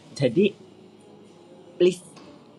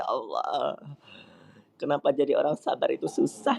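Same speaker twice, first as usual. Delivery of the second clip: ordinary talk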